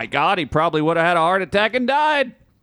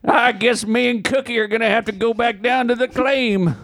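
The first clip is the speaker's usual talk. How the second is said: put-on old prospector; 8 semitones higher than usual.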